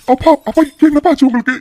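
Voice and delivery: high, loud frantic calls to father; panicked calling. Acted. Son calls to his collapsed father, high and frantic. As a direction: call out in fear, rising.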